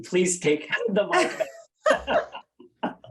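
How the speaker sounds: words broken by laughter; laughing. Real, not acted. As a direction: laugh your way through it. Laughing through emotional farewell.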